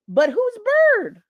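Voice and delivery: genuinely lost, asking who this is; incredulous. Real, not acted. Puzzling over the messages to viewers, incredulous. Confused question at the news.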